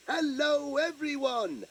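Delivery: high shouted hello everyone. Shown as a demonstration. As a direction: call out brightly to everyone. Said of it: "I'll shout".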